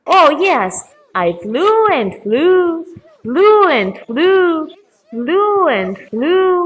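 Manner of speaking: high, looping, drawn-out repeats; rambling raven. Acted. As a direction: swoop up and linger on each word.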